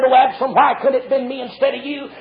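normal talking voice

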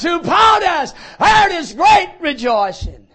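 loud, high, jubilant preaching; full-force preaching. Acted. Preacher proclaiming heaven's joy to the congregation, shouting.

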